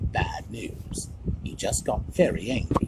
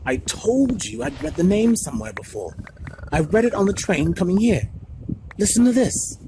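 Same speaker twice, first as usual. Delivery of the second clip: much higher and louder, thrilled; excited; 10 semitones higher, 5 dB louder than usual. A narrator's reading. Harry to Ron and Hermione, thrilled at finding Flamel. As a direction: burst out with the discovery.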